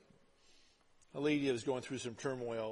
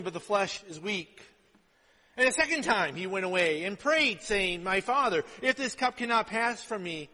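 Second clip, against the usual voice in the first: raised, driving sermon voice; forceful preaching; 5 semitones higher, 8 dB louder than usual. Acted. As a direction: preach the scripture story out with force. Preaches the Gethsemane passage forcefully.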